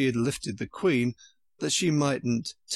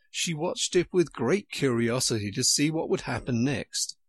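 usual speaking voice

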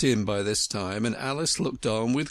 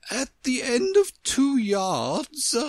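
high, slow queenly announcement; instructing Queen; 12 semitones higher, 3 dB louder, slower than usual. A narrator's reading. Queen marking distance with pegs, high instructing voice. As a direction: proclaim it high and drawn out.

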